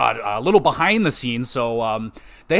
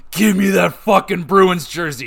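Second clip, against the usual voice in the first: voicing a wrestler grabbing a jersey, raised and louder; gruff demand; 4 semitones higher, 4 dB louder than usual. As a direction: bark it like a brawling heel. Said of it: "Edge is like".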